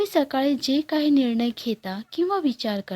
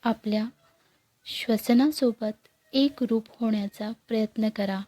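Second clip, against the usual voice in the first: guided meditation. Acted. Guide leading listeners through morning breathing meditation, calm and unhurried. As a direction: guide them gently into stillness, leaving long pauses.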